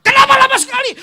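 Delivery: high, loud mock yell; husband yelling. Husband yelling at wife coming home late, hammed-up shout. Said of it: "Suami teriak-teriak".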